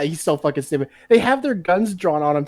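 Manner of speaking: usual speaking voice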